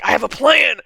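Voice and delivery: loud, high-pitched character shout; mock-dramatic cry to a brother; shouting impression. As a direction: call it out like an over-the-top schemer. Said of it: "he's like".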